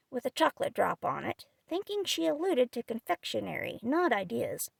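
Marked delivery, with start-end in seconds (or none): none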